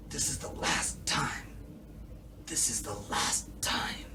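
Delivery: hoarse whisper, barely any voice; imitating David's whisper. Mocking David's whisper of a yell. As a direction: rasp it out as if he can't yell. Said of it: "David when he's just talking".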